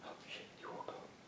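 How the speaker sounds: barely audible whisper; sneaky whisper. Real, not acted. Parent finding the baby awake, sneaky whisper. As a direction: keep it to a whisper, caught out. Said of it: "I was trying to be sneaky".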